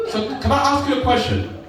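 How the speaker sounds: ordinary delivery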